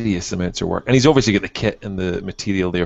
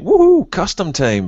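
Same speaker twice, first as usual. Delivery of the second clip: high, loud, drawn-out cheer; hammed-up "woohoo" of self-congratulation; mock-excited whoop; 9 semitones higher, 6 dB louder than usual. Hobbyist mocking his own simple head swap, mock-triumphant whoop. Acts out own glee at customizing.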